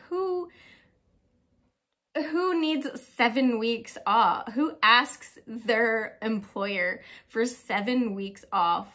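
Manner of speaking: slow, loud disbelief at herself; self-mocking, exaggerated outrage; mock indignation. Real, not acted. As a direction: drag out each word as if it's outrageous. Mocking her own absurd time-off request.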